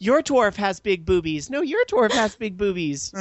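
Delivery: mock argument with slow, high words; mock bickering. Host mimics girls comparing their dwarves, mock bickering.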